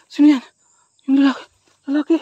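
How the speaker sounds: loud, high startled yell; frightened yell. Real, not acted. Gamer jumping at a scare, high frightened yell. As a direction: yelp it out in shock. Man appears suddenly.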